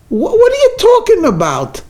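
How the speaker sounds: high, startled disbelief; baffled daughter. Acted. Daughter on the phone hearing about California, high and baffled. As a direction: stumble in sheer confusion.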